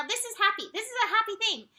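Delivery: high, loud, delighted announcement; happy news called out loud. Real, not acted. Announcing good news in the chart.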